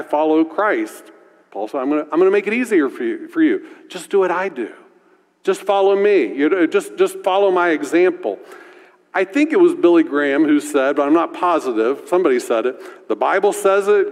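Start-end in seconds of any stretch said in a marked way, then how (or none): none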